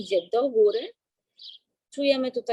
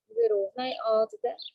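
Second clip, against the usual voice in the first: slow, calm breathing-coach voice; guided breathing. Acted.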